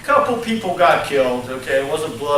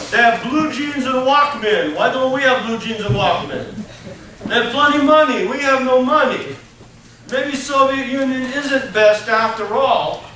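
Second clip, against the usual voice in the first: put-on higher-pitched comic Russian accent; 5 semitones higher than usual. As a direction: play a puzzled Soviet citizen in a Russian accent. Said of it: "I do a pretty good Soviet accent".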